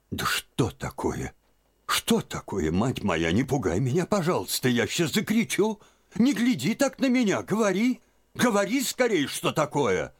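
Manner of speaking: put-on high, rising alarmed old woman; she's about to cry out; frightened old aunt. A narrator's reading. Aunt begging Liza to speak, high and alarmed.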